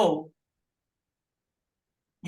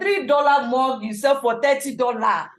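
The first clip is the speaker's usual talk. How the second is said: loud, raised voice; speaking up; 3 semitones higher, 9 dB louder, slower than usual. Real, not acted. Trainer apologising to listeners who couldn't hear, speaking up loudly. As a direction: project it out for everyone to hear. Speaks up after whispering complaint.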